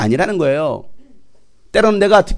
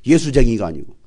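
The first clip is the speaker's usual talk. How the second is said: normal talking voice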